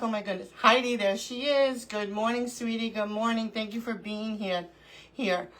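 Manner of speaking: ordinary talk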